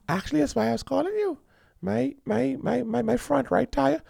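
high, soft, quick woman's voice; sweet woman caller. Acted. Woman calling Yellow about her tire, soft and sweet. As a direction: ask for help softly and sweetly.

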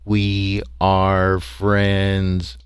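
very slow, even alien monotone; droning alien password, softly boomed; booming creature drone. A narrator's reading. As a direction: hold each word long on one pitch. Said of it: "booming out his".

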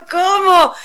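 high-pitched acted surprise; re-enacted incredulous cry; stunned disbelief. Stunned at being overcharged.

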